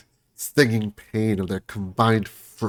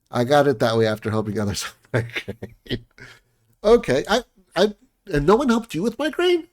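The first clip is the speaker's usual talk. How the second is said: ordinary talk, nothing marked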